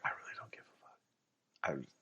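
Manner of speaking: a hushed, breathy aside; a secret confession, whispered. Acted. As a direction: whisper the true feeling under your breath. Inner thought versus outward front.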